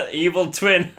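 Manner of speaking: loud, high burst of amusement; laughing. Real, not acted. Laughs at own odd voice.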